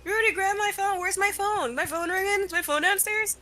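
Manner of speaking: mock panic, rattling off questions; flustered dad. Dad imitated searching the house for his ringing phone, high and frantic. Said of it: "You're like".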